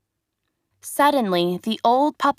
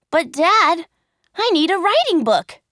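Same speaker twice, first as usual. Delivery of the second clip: put-on high, louder boyish plea; boy's plea; 8 semitones higher, 4 dB louder than usual. Pinocchio asking Dad for a book, high plea. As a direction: ask like an eager child.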